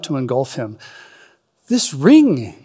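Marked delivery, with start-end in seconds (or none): 1.7-2.6 halting, frightened catch on the words; stammered in fear. Acted. Frodo stammering to Gandalf about the ring, afraid. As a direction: stumble over it, afraid. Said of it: "he stammered".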